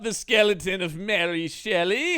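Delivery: loud, high, hammy actor's outrage; James Mason. Acted. Host at the dug-up grave, loud mock James Mason. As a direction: exclaim theatrically in a put-on star voice. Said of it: "Mason impressions".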